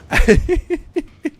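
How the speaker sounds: high wild cackling laugh; unrestrained giddy cackle. Real, not acted. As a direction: let loose a full-throated cackle. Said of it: "I get fifteen seconds to just cackle".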